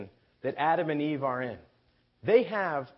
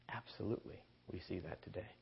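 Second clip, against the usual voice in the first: quiet assent; 7 semitones lower, 16 dB quieter, faster than usual. Real, not acted. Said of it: "quiet affirmation".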